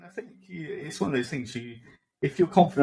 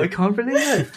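higher, hammed-up dismay; mock devastated; 7 semitones higher than usual. Friend voicing the devastated character, mock dismay. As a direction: play crushed disbelief, laughingly overdone. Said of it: "He was absolutely devastated".

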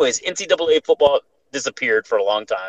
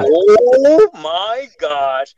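drawn out, louder, stunned; stunned gasp; 7 semitones higher, 7 dB louder, much slower than usual. Retold or imitated. Telling his uncle about the demon, drawn out and stunned. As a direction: breathe it out in slow shock. Reacting to the witch's curse.

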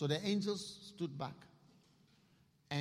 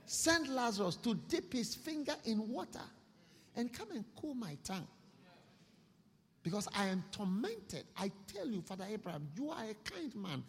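high, urgent pleading; pleading rich man; 6 semitones higher than usual. Acted. Rich man in hell begging Father Abraham, high and urgent. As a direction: beg Abraham for water, voice pitched up. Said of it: "Then he said".

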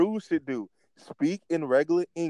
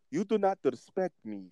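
higher-pitched mock wrestler promo; Apollo Cruz; 5 semitones higher, 4 dB quieter than usual. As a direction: lightly imitate a dictator-like wrestling character. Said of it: "he'll say the same thing".

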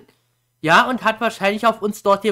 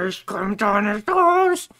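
acted thought; slower than usual. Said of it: "man denkt sich nur so".

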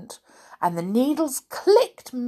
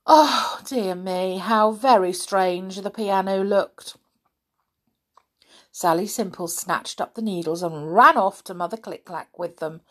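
plain reading voice

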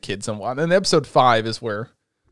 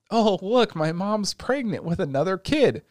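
higher, mock excited kid voice; mock surprised boy; 7 semitones higher than usual. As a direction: play the boy announcing the news, higher. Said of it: "you find out".

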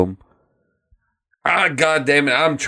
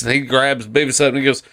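normal talking voice

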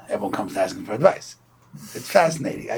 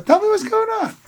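jolly stranger; 5 dB louder than usual. Retold or imitated. Jolly guy coaxing sad man.

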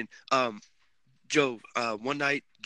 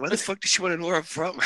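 fast, higher-pitched incredulous question; retold bafflement; 6 semitones higher, faster than usual. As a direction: blurt it in mock disbelief. Retold reaction to nosy question.

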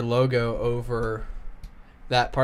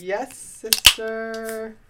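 slow, high drawn-out sassy voice; campy drawl; 10 semitones higher, much slower than usual. Pretend.

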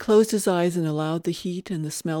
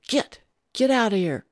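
raised, higher storyteller's shout; shooing shout; 6 semitones higher, 5 dB louder than usual. A narrator's reading. Rancher running at crows with his rifle, mild shout. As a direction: call out to scare them off, moderately loud.

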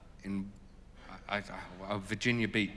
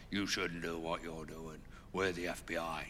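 put-on British accent; 3 semitones lower, 5 dB quieter than usual. FBI man telling him off on a panel, mild British accent. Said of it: "I did a British voice for that".

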